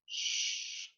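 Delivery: soft, drawn-out voiceless shush; long hushing "shh". Shown as a demonstration. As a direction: shush a class to silence. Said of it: "ask the students to be in silent".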